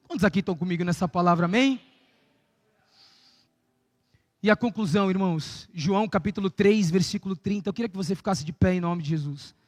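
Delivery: soft, low altar call; quiet altar call. Acted. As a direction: draw them in gently toward the altar.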